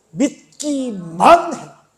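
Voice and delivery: 느리고 크게 또박또박 '믿기만'; '믿기만'을 길게 늘여 힘차게. Retold or imitated. 설교자가 말씀을 힘주어 강조. (느리고 힘 있게 강조한 명령)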